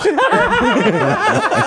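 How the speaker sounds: delighted high laugh. Real, not acted. Laughing at the voices.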